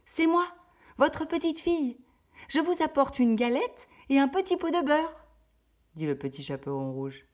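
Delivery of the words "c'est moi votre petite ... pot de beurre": put-on much higher, louder little girl voice. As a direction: announce yourself sweetly at the door. La fillette à la porte. (la fillette s'annonce d'une voix aiguë)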